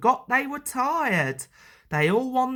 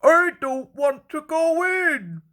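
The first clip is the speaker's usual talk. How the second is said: higher, louder nervous engine voice; Henry dreading the tunnel; reluctant Henry; 8 semitones higher, 4 dB louder than usual. A narrator's reading. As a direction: whine it, scared of the dark. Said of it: "Henry afraid of tunnel".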